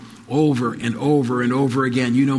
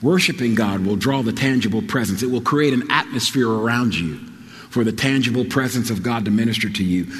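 ordinary delivery

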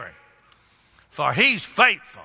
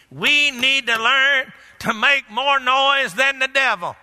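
slow, high-pitched preaching call; emphatic exhortation; 5 semitones higher, slower than usual. Real, not acted. Preacher urging congregation to out-noise the devil, emphatic exhortation. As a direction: draw it out to rouse the church.

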